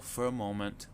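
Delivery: ordinary delivery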